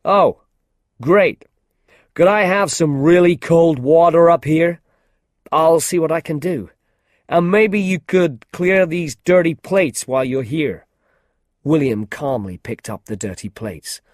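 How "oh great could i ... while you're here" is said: loud, higher, casually bossy star; breezy film star. A narrator's reading. Actor Jeff ordering room service from William, breezily bossy. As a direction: toss orders at the help without a thought.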